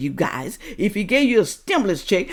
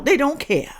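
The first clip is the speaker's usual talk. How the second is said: usual speaking voice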